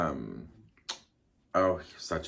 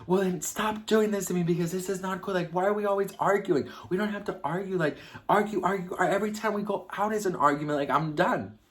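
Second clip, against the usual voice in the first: much higher, fed-up retold outburst; replaying the fight, pitched way up and exasperated; 10 semitones higher, 3 dB louder than usual. Speaker confronting Eric at the bar, high exasperated outburst.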